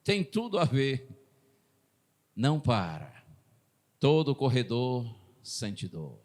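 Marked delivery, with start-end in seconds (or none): 2.4-6.2 low, slow, measured reassurance; comforting, motivating. Retold or imitated. Preacher repeating his brother's encouragement to the audience, comforting. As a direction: let each phrase sink in gently. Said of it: "pra me confortar, pra me motivar".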